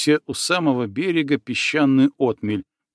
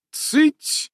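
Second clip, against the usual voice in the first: high, sharp command to hush; 14 semitones higher than usual. A narrator's reading. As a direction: snap the word out. Настя hushes panicking friend.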